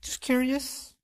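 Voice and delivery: faux-innocent mock. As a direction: play innocent with a sly lilt. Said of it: "You know what that means?".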